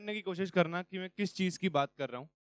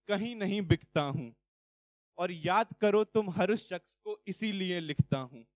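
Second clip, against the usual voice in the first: poem recitation; 6 dB louder than usual. Acted. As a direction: declaim line by line, then let the refrain fall.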